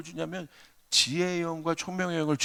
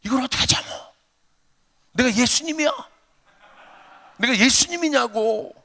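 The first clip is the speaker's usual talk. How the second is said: higher and louder, exasperated protest; indignant outburst; 7 semitones higher, 6 dB louder than usual. Retold or imitated. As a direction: throw it back at your wife in frustration. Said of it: "제가 막 그냥". (아내에게 억울하게 언성을 높이는 목소리)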